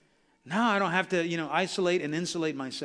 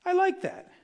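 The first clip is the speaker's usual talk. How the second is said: delighted high squeak; 11 semitones higher than usual. Retold or imitated. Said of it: "I was like".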